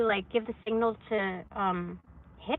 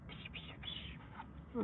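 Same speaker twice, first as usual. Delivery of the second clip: whispered psh sounds; 17 dB quieter, much slower than usual. Retold or imitated. Imitates quick signals.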